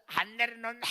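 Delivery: imitated yell to be put down; protesting mother. Stiff-legged mother carried uphill, protesting sharply.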